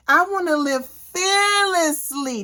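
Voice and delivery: slow, high, with "fearlessly" stressed; emphatic repeat. Shown as a demonstration. To her viewers, a slow emphatic declaration. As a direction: lean into "fearlessly" like a mantra.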